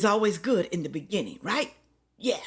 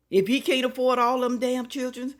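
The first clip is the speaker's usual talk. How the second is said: loud and raised; 6 semitones higher, 5 dB louder than usual. Shown as a demonstration. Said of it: "repeated advice".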